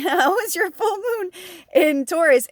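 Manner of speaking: asked through a real laugh, pitched high; laughing question. Real, not acted. Asking her viewers about the full moon while laughing.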